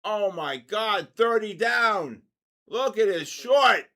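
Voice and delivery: loud, high, slow cries at the drop; real thrill watching the Nasdaq plunge; excited shouting. Real, not acted. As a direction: yell it out as the numbers fall. Market crashes live on stream.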